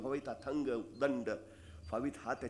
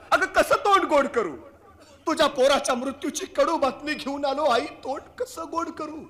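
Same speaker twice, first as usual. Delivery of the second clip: much higher, louder, breaking into pained cries; anguished king; 13 semitones higher, 10 dB louder than usual. Acted. King telling the mother of her son's death, anguished loud cry. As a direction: cry out the grief he carries. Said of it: "कळेना राजाला".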